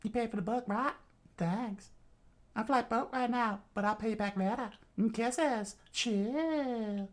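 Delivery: breezy high Southern woman; high, easygoing Southern foster mother; Southern accent. Acted. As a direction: brush him off in Southern falsetto. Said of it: "pull up my southern".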